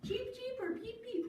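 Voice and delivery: high little chick peeps; squeaky-high bird call; baby chick. Acted. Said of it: "Do you remember what he says?".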